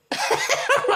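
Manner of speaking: sudden high laugh; high giggle. Real, not acted. Laughs at her own joke.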